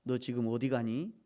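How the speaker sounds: low, soft probing questions; quiet, low reproof from God; still small voice. Acted. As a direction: ask gently, lifting the last word. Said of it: "하나님이 세미한 음성으로 그렇게 말씀".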